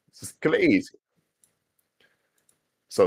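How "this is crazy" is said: high, put-on voice of mock amazement. Streamer hamming up his reaction to the website. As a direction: squeal it in a silly cartoon voice.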